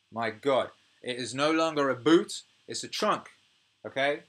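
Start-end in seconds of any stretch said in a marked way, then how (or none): none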